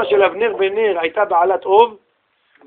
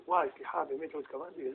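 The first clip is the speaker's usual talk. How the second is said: soft apology; 5 semitones lower, 19 dB quieter, faster than usual. Retold or imitated. Mock apology quietly.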